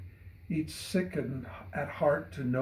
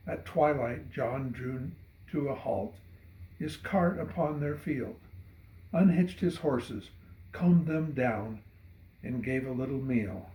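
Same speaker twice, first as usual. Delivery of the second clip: usual speaking voice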